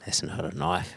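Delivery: low, quiet Crocodile Dundee line in a mock Australian accent; Aussie movie quote. Host baiting him into an Australian movie quote, mock Australian accent.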